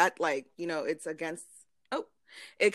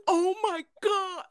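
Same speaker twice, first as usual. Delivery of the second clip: high, drawn-out mock wail; imitating friend's scream; 12 semitones higher, 4 dB louder, slower than usual. Teasing the friend over the recorded outburst, high mock scream. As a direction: mimic a friend's shriek, stretched out. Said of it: "This was".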